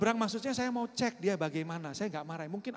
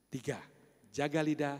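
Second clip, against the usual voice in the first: ordinary talk, nothing marked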